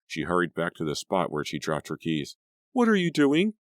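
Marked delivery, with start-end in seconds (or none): 2.7-3.5 put-on high, urgent demanding girl; frightened young woman. A narrator's reading. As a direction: demand an answer in panic. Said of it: "Grace demanded".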